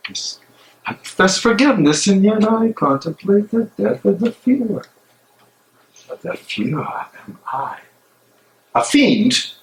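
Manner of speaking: tormented man arguing with himself alone; a conscience breaking under doubt; anguished soliloquy. Acted. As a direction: pace and wrestle with your own guilt aloud. Bonhoeffer's anguished prison monologue.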